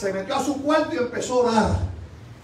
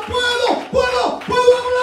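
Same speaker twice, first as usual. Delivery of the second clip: high, repeated testimony shout; acted cry of the mute; 10 semitones higher than usual. Preacher voices the mute finding speech, in high shouts. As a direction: cry it out high, building to the last word. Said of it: "los mudos abrían su boca y decían".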